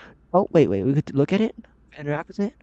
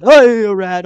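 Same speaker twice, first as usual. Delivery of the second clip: loud, high yelp of fright; sudden shriek, falling away; startled yell; 5 semitones higher, 10 dB louder, slower than usual. Real, not acted. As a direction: yell out as a rat startles you. Startled by a rat in game.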